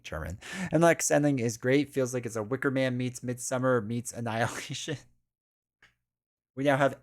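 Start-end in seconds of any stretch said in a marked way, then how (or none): none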